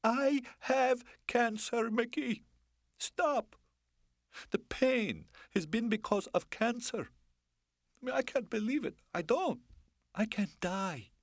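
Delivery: woman reveals her cancer to her lover, distraught. Acted. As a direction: confess it in high distress.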